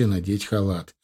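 ordinary delivery

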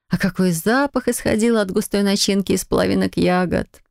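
usual speaking voice

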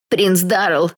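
strained yell under pressure. A narrator's reading. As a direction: cry the name out desperately. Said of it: "ещё громче завопил Тед".

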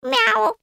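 high-pitched imitated meow; acted high cat cry. A narrator's reading. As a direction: mew like an indignant cat. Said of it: "возмущённо мяукнул тот самый Люцифер".

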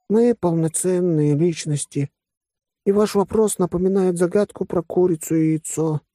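high-pitched little creature voice; furry creature, thoughtful. Acted. Furry Vasya explains his two selves to friends, thoughtful. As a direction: explain it all in a high put-on voice.

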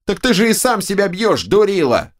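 high, squealing mock scolding; the right head mocks Draken, high and squealing; scolding ogre head. A narrator's reading. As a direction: sneer at the dim twin.

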